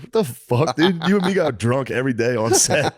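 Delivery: high-pitched mock outrage; incredulous. Comic retelling to a castmate putting on airs, incredulous. Retells calling out friend's serious act.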